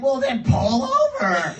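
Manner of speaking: high, loud, laughing order; laughing shout. Retold or imitated. Friends in the car yelling at him to pull over, laughing. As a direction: yell it through laughter.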